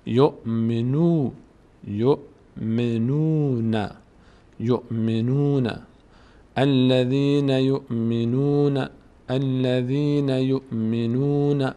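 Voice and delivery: very slow "یؤمنون", stopped hamza, long nuu; drawn-out recitation. Shown as a demonstration. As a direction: stretch the نو in یؤمنون, ending on na. (تلاوت آهسته و کشیدهٔ «یؤمنون»)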